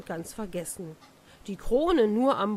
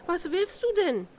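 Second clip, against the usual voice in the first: put-on girl shrieks at the frog; high alarmed question; startled high cry; 9 semitones higher than usual. A narrator's reading. Princess startled by the frog at the door, high cry. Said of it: "rief sie da laut".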